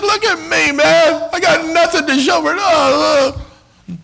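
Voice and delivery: high-pitched, hammed-up self-pity; mock wail, acted out; wailed complaint. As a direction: cry out the psalmist's grievance. Acts out the psalmist's complaint.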